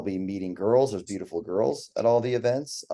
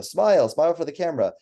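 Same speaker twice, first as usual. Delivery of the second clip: higher, louder call to pose; coaxing photographer; 8 semitones higher, 6 dB louder than usual. Acted. Photographer prompting a client during a shoot, coaxing call. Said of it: "You say, you know".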